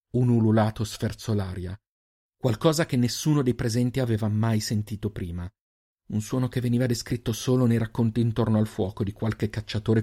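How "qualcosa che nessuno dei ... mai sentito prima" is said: ordinary delivery, nothing marked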